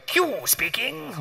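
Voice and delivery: high, slow, plummy announcer from a nineteen twenties commercial; slow and grand, like an old radio ad; posh old-fashioned voice. Retold or imitated. [name] imitating Q answering the phone, posh and slow. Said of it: "this voice comes down the phone like I'll never forget".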